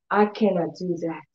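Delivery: soft, defeated little voice; quiet discouraged voice. Retold or imitated. Imitating a defeated person who says she can't. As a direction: mimic someone who gives up. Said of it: "people still come and tell me".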